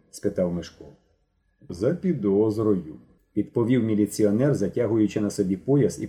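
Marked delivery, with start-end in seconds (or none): 1.7-2.9 a stolid policeman, each word weighed; slow official drawl; policeman's answer. Acted.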